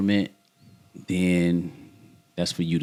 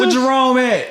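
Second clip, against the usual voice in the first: high loud teasing holler; calling from far away; 13 semitones higher, 5 dB louder than usual. Pretend. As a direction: shout it across the street. Teasing call across the street.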